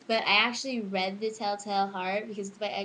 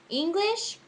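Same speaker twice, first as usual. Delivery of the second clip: dramatic pause on English; 10 semitones higher, 5 dB louder than usual. Shown as a demonstration. Contrast with other subjects.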